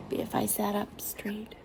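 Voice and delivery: whispered aside to herself; whispering. Real, not acted. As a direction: breathe it as an aside. Aside about her chin.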